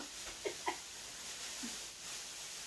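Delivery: nervous laugh. Real, not acted. Laughs at own nerves.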